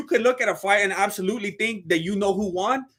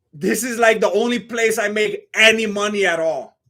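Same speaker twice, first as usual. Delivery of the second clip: ordinary talk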